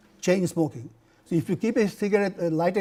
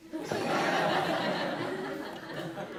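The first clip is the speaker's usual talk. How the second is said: high breathy laugh at his own joke; 14 semitones higher, 7 dB quieter than usual. Real, not acted. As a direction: laugh along with the punchline.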